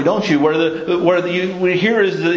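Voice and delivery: usual speaking voice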